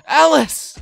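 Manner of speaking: loud, high scream of the name; horrified shout. Acted. Player screams seeing Alice badly wounded. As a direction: cry out in shock.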